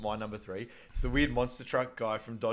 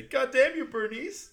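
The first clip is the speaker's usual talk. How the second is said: Bernice yell; 14 semitones higher, 5 dB louder, slower than usual. Retold or imitated. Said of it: "everyone sort of remembers the".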